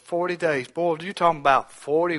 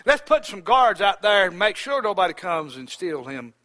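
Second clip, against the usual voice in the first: high, loud preaching cry; preaching at full force; 7 semitones higher, 6 dB louder than usual. Acted. Preacher voicing the guards' plan, loud and high. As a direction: call it out over the congregation.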